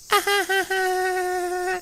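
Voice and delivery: eerie giggle. Acted. Eerie laugh.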